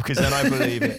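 ordinary talk

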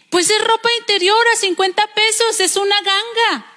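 high-pitched acted wifely insistence; insistent wife. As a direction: sell him the bargain, pitched high. Esposa insiste ante el marido. (esposa insistente, voz aguda)

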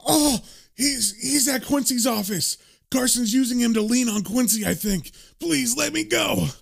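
high-pitched, pained confession; acted strained whimpering plea; squeezed man pleading. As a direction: blurt it out in agony, begging. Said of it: "getting his balls squeezed".

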